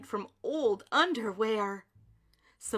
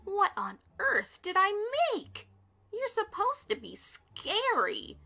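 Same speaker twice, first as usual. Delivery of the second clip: a hint of a dismayed witch, higher; lightly voiced witch; 9 semitones higher than usual. A narrator's reading. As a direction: complain in a witchy tone. Witch dismayed at her creation.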